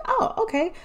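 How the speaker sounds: high, light and carefree; breezy past self. Retold or imitated. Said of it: "I was like".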